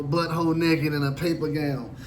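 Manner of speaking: ordinary talk, nothing marked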